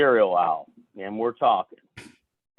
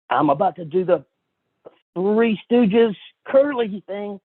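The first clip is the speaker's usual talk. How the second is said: lightly acted excitement, rising higher; excited friend imitation; 6 semitones higher than usual. Excited friend announcing his Curly bit, acted excitement. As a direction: build upward as you announce it. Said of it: "He was so excited".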